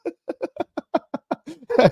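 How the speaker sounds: laughing in a very high voice; high squeaky laugh. Retold or imitated. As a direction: giggle up high in the put-on voice. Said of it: "It sound like the guy that was telling".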